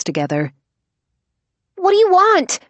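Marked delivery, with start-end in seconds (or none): 1.8-2.6 high, loud frightened shout. Acted. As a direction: yell it from under a bag. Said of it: "Max shouted through the tote".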